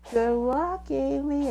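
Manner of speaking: high, drawn-out little well-wisher; Cream. Retold or imitated. Cream wishes Amy luck, high character voice. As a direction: sing out the farewell high. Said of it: "until Cream and Big goes".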